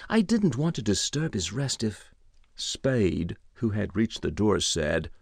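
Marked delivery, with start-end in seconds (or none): 0.1-2.1 higher-voiced, apologetic butler; sheepish stammer. A narrator's reading. Butler caught at the keyhole excusing himself, sheepish. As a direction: keep excusing yourself in a higher, deferential voice.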